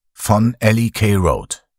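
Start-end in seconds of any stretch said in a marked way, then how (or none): none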